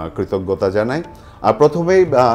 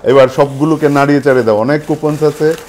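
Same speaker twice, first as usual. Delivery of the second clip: loud, high hype host; booming prize announcer; 5 semitones higher, 7 dB louder than usual. Acted. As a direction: pump up the crowd before the draw. Host hypes the draw crowd.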